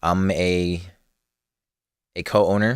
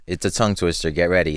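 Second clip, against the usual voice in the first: normal talking voice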